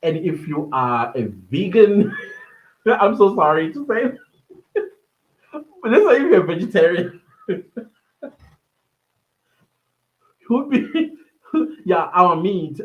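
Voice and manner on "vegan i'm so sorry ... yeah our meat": high, loud giggling through the words; laughing. Real, not acted. Teasing vegan viewers about meat, laughing. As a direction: laugh while apologising to vegans.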